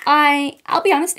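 mock American accent as Kendall Jenner, valley-girl vibe; terrible American accent. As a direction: do a hammed-up American model voice. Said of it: "my terrible American accent".

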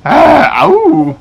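a loud high yelp of pain; sharp ow. Real, not acted. Player startled by the dog, loud high yelp. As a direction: cry out as if bitten.

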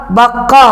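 بقا said with a clear قاف sound, not كاف; teacher showing that بقا has قا not كا; contrast sound. Shown as a demonstration. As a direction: stress the قا in بقا so kids hear it isn't كا. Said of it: "قا مش كا يا ولاد".

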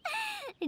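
a short high chuckle that falls away; quick bright giggle, fading; amused laugh. Acted. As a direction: laugh lightly at the flustered companion. Yoimiya laughs at Paimon.

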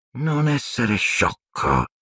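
slow, lightly growled threat; a hint of a growling dark lord. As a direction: drag out the warning menacingly. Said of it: "ringhiò il volto".

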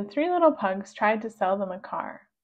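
ordinary delivery

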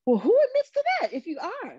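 high, fast disbelieving squeal; shrill mock disbelief; incredulous. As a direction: act stunned he'd admit it. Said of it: "And I was like".

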